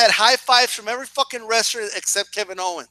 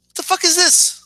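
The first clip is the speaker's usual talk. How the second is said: exasperated outburst; 10 semitones higher than usual. Real, not acted. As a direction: blow up at the absurdity. Exasperated rant peak.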